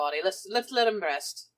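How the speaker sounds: ordinary delivery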